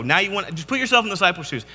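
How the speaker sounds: ordinary delivery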